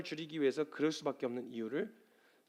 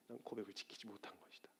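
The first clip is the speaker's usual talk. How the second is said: very soft, low near-whisper; hushed admission; 5 semitones lower, 17 dB quieter than usual. Acted. Peter admitting failure without excuse, hushed. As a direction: confess it under your breath.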